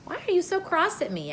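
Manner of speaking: a light mock-wounded child's reproach; reproachful. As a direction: chide them sweetly for being cross.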